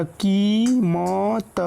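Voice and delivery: कीमत slowly built up, ī held; sounded out in parts. Shown as a demonstration. Teacher showing how to read it, कीमत drawn out in pieces. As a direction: sound out कीमत part by part with a long ī. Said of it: "आप इसको कैसे पढ़ेंगे".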